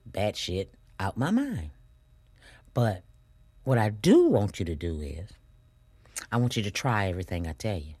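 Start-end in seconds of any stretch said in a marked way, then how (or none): none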